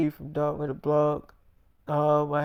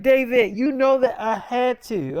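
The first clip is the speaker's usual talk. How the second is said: raised voice; 6 semitones higher, 5 dB louder than usual. Retold or imitated. Girlfriend defends herself in argument.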